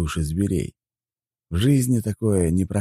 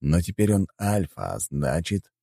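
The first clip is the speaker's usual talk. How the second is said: plain reading voice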